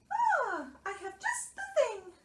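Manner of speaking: high little mouse voice; eager Mouse. A narrator's reading. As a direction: pipe up with a fresh idea. Mouse offers another remedy.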